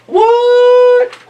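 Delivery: very high, stretched-out "what"; comic high-pitched disbelief; astonished lion. Acted. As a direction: wail it in mock shock. Lion's astonished reaction in story.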